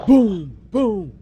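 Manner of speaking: loud, high boom noises; explosion sound effect. Acted. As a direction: shout booms like a cartoon blast.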